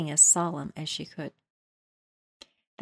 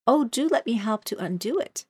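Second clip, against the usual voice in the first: eager to help; 5 semitones higher, 4 dB louder than usual. Acted.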